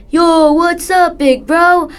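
put-on high, loud and slow kid brother; little brother voice. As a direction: call out like an excited little brother. Little brother greets the narrator.

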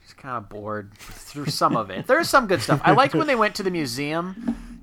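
ordinary talk

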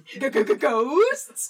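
g-g-ghosts with a fake scared stammer; mock scared stutter. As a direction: stutter it like you're terrified.

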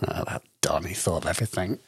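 a quick unvoiced aside; whispering. Real, not acted. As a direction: whisper it as a fond joke. Aside joke about the designer.

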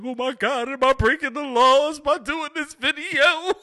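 high, slow, worried imitation of a friend; hammed-up nervous voice; mock panicked friend. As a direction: squeak it out like he's scared of the law. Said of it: "he's like".

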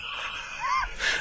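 high burst of laughter. Real, not acted. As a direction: crack up at the memory. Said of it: "laughs hard".

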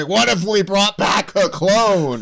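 hammed-up high, slow exclamation; terrible Stan Lee. Writer pitching a clone to his colleague, bad imitation. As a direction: badly imitate an excited comic writer, stretching it out. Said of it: "that was a terrible Stan Lee imitation".

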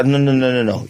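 normal talking voice

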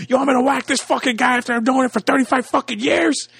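mock Pesci outrage. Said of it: "even they have a code like".

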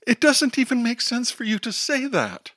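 high, syrupy scolding; sweet condescending teacher voice. Acted. Teacher scolding the runaway girl, high and syrupy.

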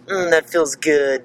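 slow, savoring "Mm, that feels good"; drawn out and contented; relieved sigh. Retold or imitated. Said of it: "And I'd be like".

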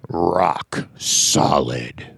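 slow, low Viking boast. Acted. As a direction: drag it out like a proud Viking warrior. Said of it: "Did you read 'em with a Viking voice in mind?".

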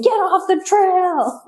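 high, slow mock yell at a snake; retold yelling. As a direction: drag out a high yelling order. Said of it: "hears us screaming".

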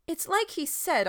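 put-on boy speaking up too loudly to the shepherd. A narrator's reading. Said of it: "louder than was polite".

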